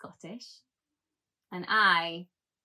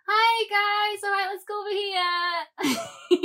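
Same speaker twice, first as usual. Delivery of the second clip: very high, loud, feeble crowd-wrangler; whiny little voice; 12 semitones higher, 5 dB louder than usual. Acted. Addressing apocalypse crowds, whiny little voice. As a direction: pipe it out in a whiny little squeak. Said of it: "with my whiny little voice".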